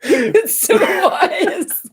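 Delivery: high, drawn-out words through laughter; laughing. Real, not acted. Co-host cracking up at the vague wisdom, laughing. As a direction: crack up while talking.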